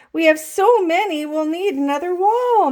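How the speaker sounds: teacher thrilled by the full wall; excited exclaim. A narrator's reading. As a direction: exclaim it with delight. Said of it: "Missus Ruler exclaimed".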